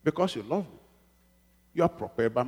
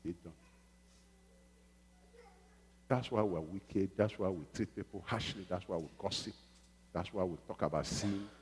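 softer lament to God; quiet prayer; 4 semitones lower, 11 dB quieter than usual. Retold or imitated. Preacher retelling his talk with God, quiet and sorrowful. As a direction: confide it sadly to the Lord. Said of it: "I said".

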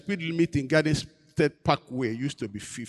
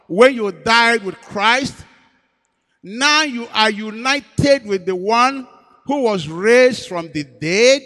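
slow emphatic preaching; 4 semitones higher, 6 dB louder, much slower than usual. Acted.

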